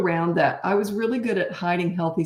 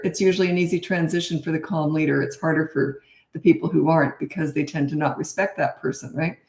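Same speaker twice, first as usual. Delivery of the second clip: ordinary delivery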